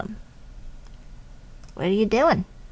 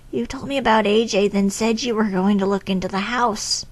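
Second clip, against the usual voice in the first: ordinary talk, nothing marked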